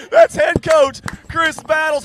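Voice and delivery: loud announcing. Real, not acted. Hyping coach on live stream.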